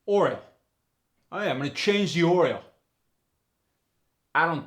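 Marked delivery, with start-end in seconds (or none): 0.1-2.6 loud "oil" as oyl with an added R; New York oil. Shown as a demonstration. Teacher demos the New York oil with an R, loud. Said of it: "oil becomes".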